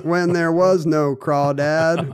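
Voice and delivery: Raising Arizona voice. Retold or imitated. Continues Raising Arizona riff.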